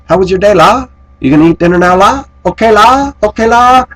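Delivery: high, loud sing-song imitation with la tags; Filipina friend imitation. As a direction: bounce each la up like a chatty friend. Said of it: "and she'd say".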